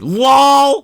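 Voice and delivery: loud, high, drawn-out yell; big mock-hearty holler; shouted reaction. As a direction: belt out the word. Reacting loudly to the post.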